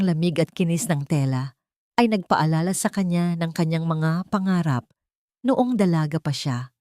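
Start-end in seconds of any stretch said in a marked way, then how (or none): none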